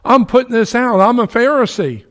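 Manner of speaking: high, loud, defiant address; Paul challenging the council, loud and high; bold declaration. Retold or imitated. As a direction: declare it to the accusers, pushing hard. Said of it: "and said".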